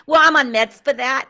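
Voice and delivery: loud, high mock New York accent. Guest joking back to host, in a loud put-on New York accent. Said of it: "You want me to talk New Yorkie?".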